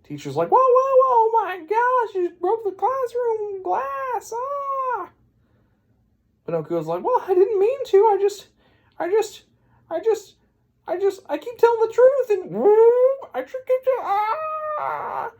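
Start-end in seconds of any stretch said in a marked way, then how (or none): 0.5-5.1 shrieky high mock panic, ending in a wail; panicked teacher. Teacher seeing the broken classroom glass, mock-panicked squeal. 7.0-15.3 high, loud, stammering mock distress; frantic pleading. Pinocchio pleading as his nose grows, hammed-up frantic squeal.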